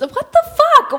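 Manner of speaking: loud, very high-pitched disbelief; shocked outburst. Real, not acted. Reacting to friends saying the film is about strippers, shocked outburst. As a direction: exclaim it in real, appalled surprise. Shocked on learning movie's subject.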